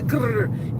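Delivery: "grrr" with a long trilled R; rolled R. Retold or imitated. Newcomer mimicking Indian English, trilled "grrr". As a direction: roll the R all through "grrr". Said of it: "we don't roll our R's".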